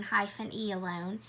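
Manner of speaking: normal talking voice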